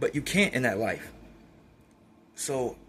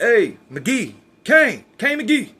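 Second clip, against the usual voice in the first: loud, slow, high call across the dorm; drawn-out guard's call for McGee; mock guard hollering a name; 6 semitones higher, 7 dB louder, much slower than usual. Guards calling him out.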